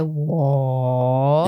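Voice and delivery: 我 pushed far too low, exaggerated; wrong way: plunge 我 way down; overly low tone. Shown as a demonstration. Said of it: "goes very low".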